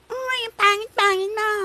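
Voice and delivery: very high, squeaky parroting voice; mocking copycat child. Mocking someone who copies an accent, high childish mimicry. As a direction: mimic it back like a bratty kid. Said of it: "Parang ganon. That's what you're doing when you're trying to copy".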